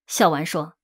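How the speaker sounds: ordinary delivery, nothing marked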